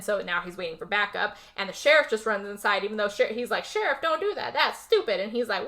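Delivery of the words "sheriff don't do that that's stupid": higher, lightly acted warning; mock protest. Cop to sheriff running inside, lightly mocked protest.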